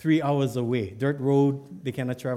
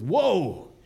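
startled, pitch swooping high then falling; a long, alarmed "whoa"; 5 semitones higher, 4 dB louder than usual. Acted. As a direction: cry out at a shocking sight. Startled reaction in acted story.

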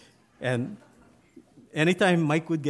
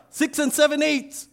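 very high, loud recruit answer; a recruit shouting his size back; shouted reply; 13 semitones higher, 6 dB louder than usual. Acted. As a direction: yell back to the sergeant.

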